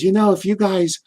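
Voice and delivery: lightly put-on New York accent. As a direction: imitate an inviting mother in New York accent. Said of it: "with that New York accent".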